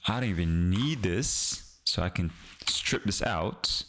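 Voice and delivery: ordinary delivery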